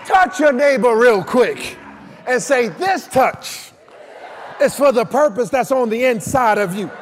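loud, driving sermon with punched phrases; full-force preaching. Real, not acted. Preacher telling the congregation to touch their neighbor, full-force preaching.

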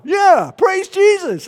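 high, drawn-out loud praise; imitated exuberant command. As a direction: belt it out like a fiery prophet. Prophet insists on praise, acted.

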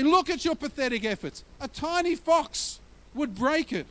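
high sneering taunt; mocking jeer. Preacher voicing mockers taunting the builders, high and sneering. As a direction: jeer at their pathetic efforts. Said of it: "And they say".

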